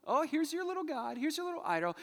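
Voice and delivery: high mocking voice, talking down; patronizing mock. Preacher voicing Isaiah mocking idols, high patronizing tone. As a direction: belittle the little god. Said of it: "Isaiah's like".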